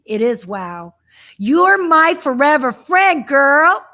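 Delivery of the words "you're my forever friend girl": louder, emphatic and affectionate; exuberant declaration. Real, not acted. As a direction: proclaim it with joy.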